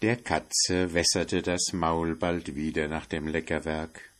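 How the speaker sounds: ordinary delivery, nothing marked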